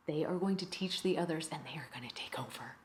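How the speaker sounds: hushed, whispery warning; ominous hush. Acted. Teacher warns the bad kids will take over, ominously hushed. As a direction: drop almost to a whisper, conspiratorial.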